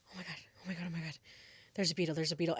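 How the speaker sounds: very soft, low panicked murmur; frozen in fear, panicking under the breath; hushed panic. Retold or imitated. Teller relives spotting a huge beetle on the wall, hushed panic.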